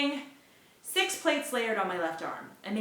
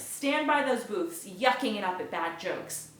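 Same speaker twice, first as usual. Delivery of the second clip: ordinary delivery, nothing marked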